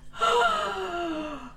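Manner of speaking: high, sharp mock-offended gasp; shocked gasp. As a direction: gasp as if scandalised. Shocked reaction.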